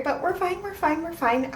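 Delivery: high, quick nervous reassurance; high-pitched reassurance. Real, not acted. Expired cacao, self-reassuring.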